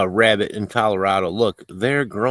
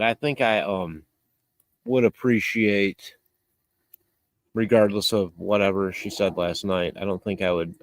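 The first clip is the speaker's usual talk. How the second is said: ordinary talk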